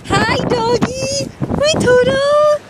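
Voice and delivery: high, slow sing-song pet voice. Real, not acted. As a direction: coo to the dog. Talking to her dog.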